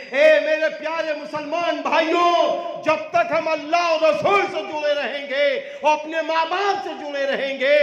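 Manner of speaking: high, ringing appeal to the brothers; fiery preaching. Acted. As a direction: preach it out to the whole hall. Preaching at full force.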